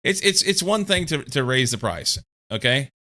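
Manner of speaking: ordinary talk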